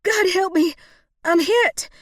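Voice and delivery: high, loud plea for help; pained yell. A narrator's reading. Shot woman begging God for help, high loud yell. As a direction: cry out in shock, high and loud. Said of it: "Rosalie yelled".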